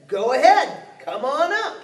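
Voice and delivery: bold challenge. Acted. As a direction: dare the troll to come up. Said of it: "that billy goat said".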